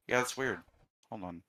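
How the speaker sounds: usual speaking voice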